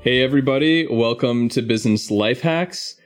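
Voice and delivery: ordinary talk, nothing marked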